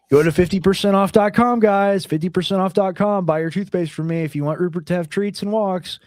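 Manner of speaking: loud upbeat sales pitch; ad read. Acted. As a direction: pitch the store like an announcer. Ad plug for his store.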